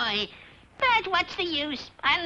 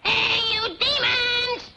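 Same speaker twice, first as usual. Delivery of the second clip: slow, higher summoning call; 6 semitones higher, slower than usual. Acted. Witch calling demons for the children, slow hopeful call.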